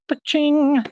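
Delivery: a sharp, high, bright "Pa-ching!" like a till ringing; mock cash-register ching. Boasting to chat as instant karma hits, high mock ching. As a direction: ring it out like money landing.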